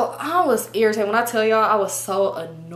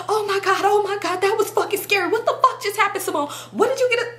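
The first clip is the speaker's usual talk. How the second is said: high, whiny hammed-up freak-out; mock panic; 10 semitones higher than usual. Driver reacting after the getaway, high mock panic. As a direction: imitate a friend panicking in a high voice.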